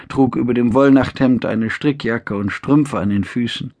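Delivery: ordinary delivery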